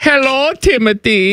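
high, exaggerated German accent. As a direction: ham up a German tourist. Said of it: "in your German voice".

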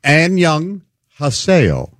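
syllable-by-syllable Korean hello, slow and spaced; slow demonstration. Shown as a demonstration. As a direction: spell out "And Young Haseo" slowly by ear. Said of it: "What is it?".

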